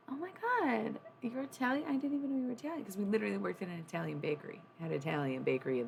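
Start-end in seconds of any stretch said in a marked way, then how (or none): 0.1-2.8 high, breathless imitation of a shocked friend; mock surprise. Coworkers finding out she's Italian, mock astonished. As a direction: act out the coworkers' gasping surprise. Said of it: "and they'd be like".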